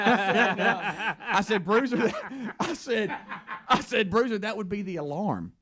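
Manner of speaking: laughing through the words. Real, not acted. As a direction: tells it while cracking up.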